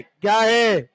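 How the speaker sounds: normal talking voice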